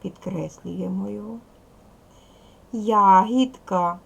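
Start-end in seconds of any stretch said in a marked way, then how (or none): 2.7-4.0 Ягідка loud and slow, я-гід-ка with a voiced д; syllable by syllable. Shown as a demonstration. Teacher dividing a word for pupils, Ягідка slowly syllable by syllable. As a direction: stretch Ягідка out in three syllables, very loudly.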